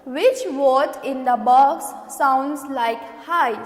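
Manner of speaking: a slow reading of the question. Shown as a demonstration. Teacher reads the worksheet question aloud slowly. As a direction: read out which word in the box sounds like hi slowly. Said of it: "question here".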